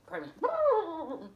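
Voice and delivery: ordinary delivery, nothing marked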